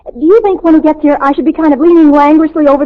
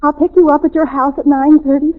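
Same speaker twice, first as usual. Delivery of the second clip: usual speaking voice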